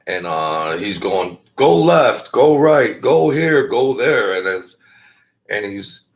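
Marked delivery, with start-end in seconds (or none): 1.5-4.4 high, loud imitation of ordering someone around; bossy directions. Passenger giving the driver directions, imitated as loud and bossy. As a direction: rap out each direction, pitched up.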